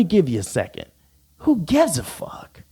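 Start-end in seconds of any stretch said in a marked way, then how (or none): 1.4-2.6 high defiant drawl. Acted. Defiant rant.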